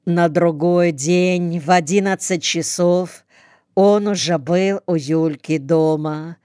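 usual speaking voice

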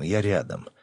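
ordinary delivery

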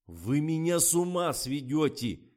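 slow, high, loud exasperation; raging. A narrator's reading. Director flies off the handle, enraged. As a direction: explode at the whole crew. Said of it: "пошёл в разнос Фомин".

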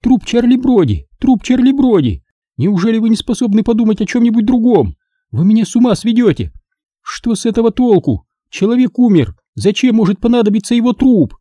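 she's at the end of her rope; hysterical outburst. A narrator's reading. Margo raging at Engel over the corpse.

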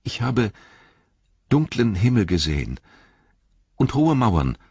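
high, hesitant report with pauses; halting. A narrator's reading. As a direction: begin haltingly, feeling for each phrase. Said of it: "Mit leiser, stockender Stimme". (stockend, mit hoher Stimme)

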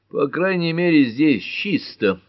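high, drawn-out grudging remark; mildly grouchy old judge; grumbling. A narrator's reading. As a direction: grumble it, stretching the last words. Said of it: "проворчал судья Мартин".

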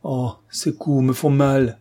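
slow, faltering and pained; a mild faint fearful plea, slowing down; frightened. A narrator's reading. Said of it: "bégaya Coralie". (peur légère, lente)

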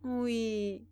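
long drawn-out, drowsy yes; sleepy, sustained murmured reply; dozing off. A narrator's reading. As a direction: let the word sink into sleep. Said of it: "lui dit-elle en s'assoupissant sur son épaule".

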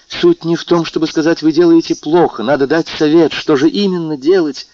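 urgent pleading. A narrator's reading. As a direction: demand a real answer, voice rising. Valentina pleads, demanding his advice.

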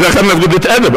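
laughing quickly while talking; a quick laugh through the words. Real, not acted. Laughing about the awkward question.

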